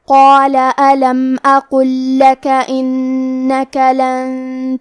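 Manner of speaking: measured Quranic chant; Quran recitation. Acted. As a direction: chant each verse slowly with long held vowels.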